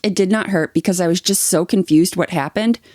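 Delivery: ordinary delivery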